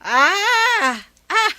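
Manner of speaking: loud high gasp of remembering, then a laugh; sudden realization cry. Acted. Grandparent suddenly recalling the grandson's birthday, loud cry then laugh.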